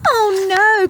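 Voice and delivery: worried father crying out; alarmed cry. Acted. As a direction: cry out as the egg teeters. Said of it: "cried Gabbler".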